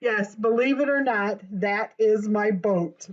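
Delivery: ordinary delivery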